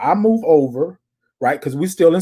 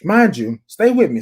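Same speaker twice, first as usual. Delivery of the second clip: normal talking voice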